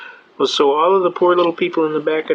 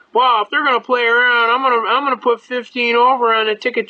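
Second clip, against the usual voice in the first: indignant cop; 7 semitones higher than usual. Retold or imitated. Cop complains to judge.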